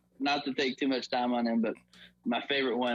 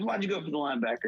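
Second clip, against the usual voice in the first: quick, higher-pitched questioning; retold coach's challenge; 5 semitones higher, faster than usual. Coach questions player during film session, quick pressing challenge. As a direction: press the player without shouting.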